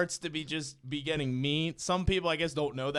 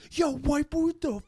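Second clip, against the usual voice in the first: higher mock catcall from a passerby; imitated street catcall; 11 semitones higher than usual. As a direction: call out like a rude stranger on Broad Street. Retold catcall from a stranger.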